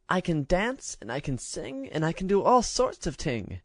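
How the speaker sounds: put-on high, chirpy child who says ting; a cheeky little show-off; boasting baby-talk girl. A narrator's reading.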